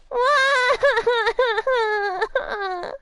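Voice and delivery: high, falsetto cartoon sob; voiced wail. Pearl runs off wailing at Nick, a hammed-up sob. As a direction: wail like a heartbroken kid. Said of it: "I made Pearly cry".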